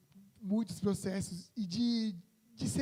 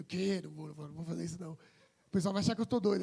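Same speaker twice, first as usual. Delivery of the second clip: ordinary delivery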